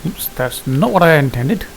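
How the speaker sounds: ordinary delivery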